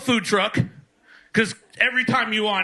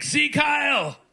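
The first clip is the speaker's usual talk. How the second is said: high-pitched comic cry; mock salute shout; 7 semitones higher, slower than usual. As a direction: call it out as a parody rallying cry. Mock salute shouted in stand-up bit.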